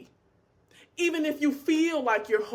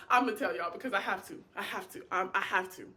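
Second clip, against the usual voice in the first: usual speaking voice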